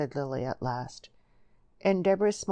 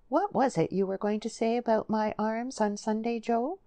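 put-on high girlish character voice, coaxing; coquettish Lily; 6 semitones higher than usual. Lily leads Joe on about her arms, coquettish. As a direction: lift the voice high and coax him.

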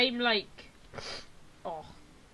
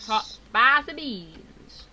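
put-on slow, loud, drawn-out American accent; bad American accent; 5 semitones higher, 5 dB louder, much slower than usual. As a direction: drag out each word like a cowboy. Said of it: "bad American accent".

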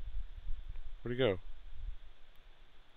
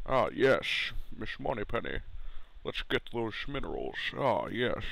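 suave spy impression with slushy s sounds; spy film voice. Player playing spy to Miss Moneypenny, slushy suave voice. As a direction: slur every s into sh, swooping the pitch.

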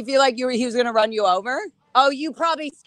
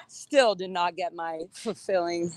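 ordinary talk, nothing marked

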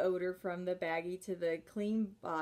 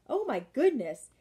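high, loud shocked gasp of a line; loud prim dismay; shocked society lady; 5 semitones higher, 7 dB louder than usual. Acted. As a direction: recoil at the smell, prim and appalled. Miss Lily smells the odor.

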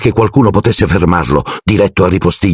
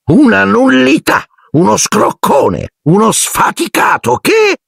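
high, slow sneering list of insults; scornful aunt Marge; slower than usual. A narrator's reading. Aunt Marge insulting Harry's father, high slow sneer. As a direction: spit out each insult slowly. Aunt voiced in read-aloud story.

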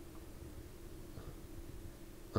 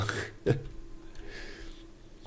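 breathy laugh; 8 dB quieter than usual. Real, not acted. Laughs at own joke.

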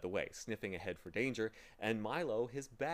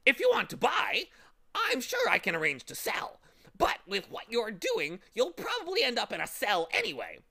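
much higher, louder squawking bird voice; sly bird; 12 semitones higher, 10 dB louder than usual. Acted. Bird offering to sell to the boy, sly squawk. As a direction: bargain slyly then warn.

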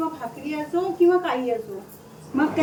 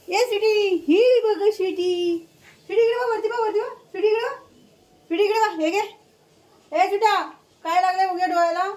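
calling out; 6 semitones higher than usual. Real, not acted. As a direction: get the dog to look up. Calling the dog to look up.

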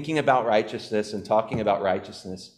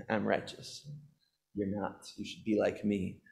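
softer, mock smug voice; holier than thou; 3 semitones lower, 12 dB quieter than usual. Mocking holier-than-thou types to the congregation, quiet smug. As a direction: sneer it quietly like a self-righteous prig. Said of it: "holier than thou types".

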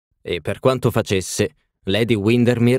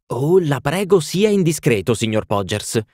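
eager exclamation; 6 semitones higher than usual. A narrator's reading. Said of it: "esclamò Lady Windermere".

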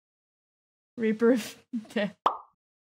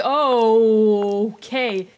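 ordinary talk

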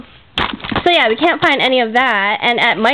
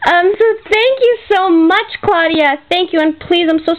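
teary thanks; 5 semitones higher than usual. Real, not acted. Vlogger thanking her friend for gifts, on the verge of tears. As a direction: voice breaking, higher and tearful. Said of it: "I am emotional and touched".

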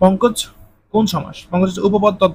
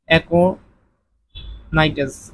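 very slow dictation; much slower than usual. Shown as a demonstration. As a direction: stretch aconitase out very slowly. Teacher dictates answer very slowly. (খুব ধীরে aconitase)

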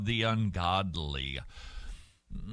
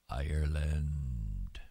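Ireland whispered slowly, lower, no voice; 9 semitones lower, 3 dB quieter, much slower than usual. Real, not acted. Lamenting the country, a slow sad whisper. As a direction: breathe the word Ireland out in a whisper.

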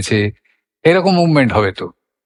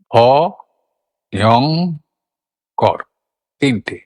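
ভ, ইয়ং, কর split by pauses; syllable by syllable; slower than usual. Shown as a demonstration. Teacher breaks the word for students, syllable by syllable. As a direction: count out the syllables separately. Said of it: "কটা syllable?".

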